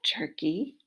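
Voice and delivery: plain reading voice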